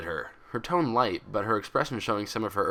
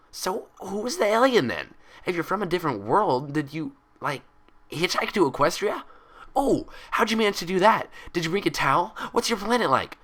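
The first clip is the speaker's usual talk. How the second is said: high, loud, eager rush; excited chatter; 10 semitones higher, 4 dB louder than usual. Acted. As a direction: pepper her with questions.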